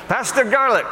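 high-pitched mock call of a nickname; Sammy's greeting. Pastor quoting his friend in Israel, high imitated call. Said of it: "that's what Sammy calls me now".

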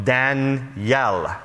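Danielle slowly split, Dan-YELL, weight on the end; stress on final EL. Retold or imitated. Tour guide correcting his name, Danielle slowly stressed Dan-YELL. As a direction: say Danielle slowly, leaning on the last syllable. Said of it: "You always put the accent on L".

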